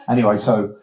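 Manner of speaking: ordinary talk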